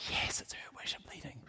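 giddy hushed glee; delighted whisper. Retold or imitated. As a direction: whisper it like a happy secret. Delight when she leads worship.

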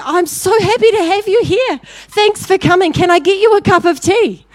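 very high, loud, eager greeting; gushing welcome. Acted. Graham greeting his front-row opponents, gushing welcome. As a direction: welcome them with over-the-top delight.

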